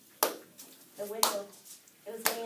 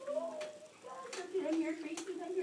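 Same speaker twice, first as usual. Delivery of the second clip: frightened gasp; 8 semitones higher than usual. Retold or imitated. Girl terrified in bed.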